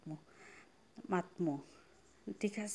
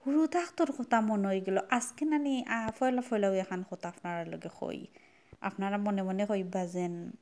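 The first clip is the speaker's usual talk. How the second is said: ordinary talk, nothing marked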